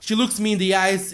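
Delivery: normal talking voice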